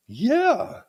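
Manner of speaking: very high, hammed-up yes; excited. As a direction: answer in a squeal of mock delight. Same excited voice answering the court.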